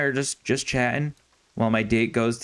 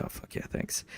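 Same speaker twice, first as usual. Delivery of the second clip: breathed thanks under his breath; quick hushed relief to his buddy; relieved whisper; 14 dB quieter than usual. Retold or imitated. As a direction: whisper it like a grateful escape. Relieved aside as buddy rescues him.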